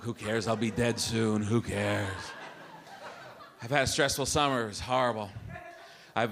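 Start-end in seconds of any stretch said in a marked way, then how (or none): 0.0-2.1 careless smoker. Retold or imitated. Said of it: "they just smoke".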